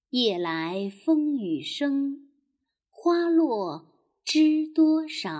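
一字一顿地慢慢吟诵古诗; 像给孩子示范背诗那样拖长节奏; 慢速诗歌朗诵. Acted. 第二遍朗诵唐诗. (缓慢地朗诵古诗)

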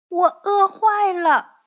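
put-on high, loud child's complaint; hungry baby dinosaur. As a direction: whine loudly as a starving little dinosaur.

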